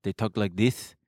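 mock Israeli accent with a lisp. Shown as a demonstration. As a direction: demonstrate a lisping Israeli accent. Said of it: "the most Israeli accents have a lisp".

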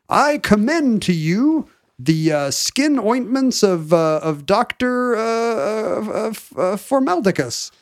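slow, high grand commendation; pompous duke letter. Retold or imitated. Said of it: "fake letters from the Duke saying".

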